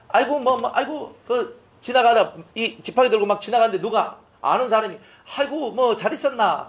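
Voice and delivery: high, lightly voiced neighbour calling out hello; a hint of an eager acquaintance stopping you on the road; mock cheerful hello from a passerby; chatty passerby greeting.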